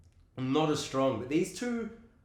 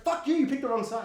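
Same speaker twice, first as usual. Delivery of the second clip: mock angry retort; 9 semitones higher, 4 dB louder than usual. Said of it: "she's like".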